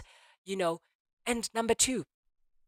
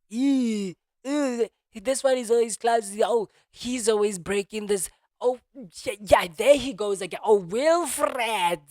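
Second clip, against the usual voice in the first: shrill mocking voice, higher and louder; mocking scolders; 4 semitones higher, 8 dB louder than usual. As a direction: sneer like a nagging onlooker. Mocking people when phone falls.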